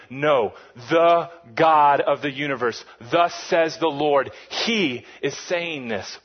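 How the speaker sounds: solemn "thus says the Lord" proclamation; authoritative decree. Retold or imitated. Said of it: "He's saying".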